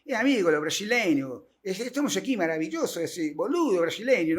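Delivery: lilting mock Argentine Spanish; Argentine accent. Imitating an Argentine greeting a Brazilian, Argentine accent.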